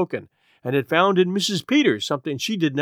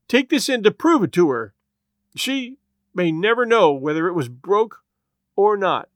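higher, louder choked pleading; 5 semitones higher, 4 dB louder than usual. A narrator's reading. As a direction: choke the words out through tears. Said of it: "and choked out".

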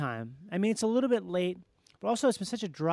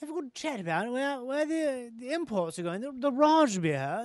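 put-on tipsy posh British accent, higher and slowed; drunken posh British accent; 3 semitones higher than usual. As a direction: slur it like a tipsy English squire. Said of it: "You could be wasted and you just".

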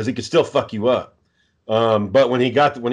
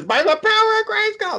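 mock excited yell. Imitating Roger running in as He-Man, high mock yell. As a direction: squeal it like an overexcited fan. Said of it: "just yelling and shit".

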